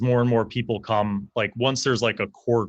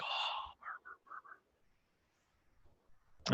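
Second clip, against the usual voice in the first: whispered murmur; crowd murmuring; 18 dB quieter than usual. Acted. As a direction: hush it like gossiping onlookers. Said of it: "people are like".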